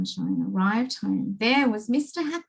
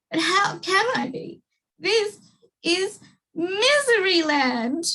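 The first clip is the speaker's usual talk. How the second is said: crying king; 10 semitones higher than usual. Acted. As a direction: break into tears as you say it. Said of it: "cried the king".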